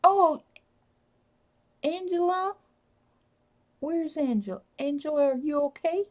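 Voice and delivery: high, lifted voice calling a name; high worried call for Angela. Real, not acted. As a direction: call across the room to find her.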